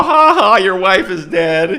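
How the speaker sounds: high, loud mock taunt; hammed-up mocking crowd, slowing on the taunt; jeering laughter. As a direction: imitate a heckling crowd, laughing derisively. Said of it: "And they go".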